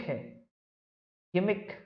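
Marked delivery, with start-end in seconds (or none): 1.3-1.7 "गिमिक" on its own, hard g; correct pronunciation. Shown as a demonstration. Teacher repeating the word for students, "गिमिक" with a hard g. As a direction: model "गिमिक" with a g as in "go". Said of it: "सही करें".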